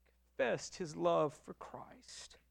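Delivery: whispered softly, nearly breath alone; a reverent whisper at the story's peak. Real, not acted. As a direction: let it fall to a hushed whisper. Hushed climax of hospital story.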